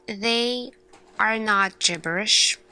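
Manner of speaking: normal talking voice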